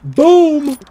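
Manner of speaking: very high, booming shout; triumphant shot call. Real, not acted. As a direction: shout it like the gun going off. Calls out own sniper shot.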